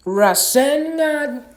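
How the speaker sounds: high, loud, drawn-out battle scream; screamed attack name. Acted. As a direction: scream the move name slowly with everything. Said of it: "he screams out".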